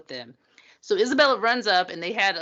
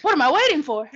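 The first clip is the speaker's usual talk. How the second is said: higher, louder playful echo; mock reply; 6 semitones higher, 6 dB louder, faster than usual. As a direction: throw the question back. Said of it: "What are you waiting for".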